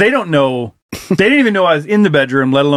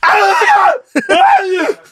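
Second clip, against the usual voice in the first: very high-pitched screams, twice over; reenacted scream; 3 dB louder than usual. Fan reliving a home run into the stratosphere, screaming. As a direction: scream like a fan blown away. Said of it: "And I just fucking scream".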